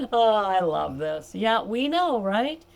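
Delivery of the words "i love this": ordinary talk, nothing marked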